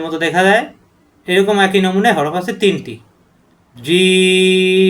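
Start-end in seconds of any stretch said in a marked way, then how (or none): 3.8-4.9 জিম stretched very slow, vowel held long; long drawn-out jim. Shown as a demonstration. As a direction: hold the ee in জিম for four beats. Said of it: "চার আলিফ পরিমাণ টানতে হবে". (জিম চার আলিফ টেনে)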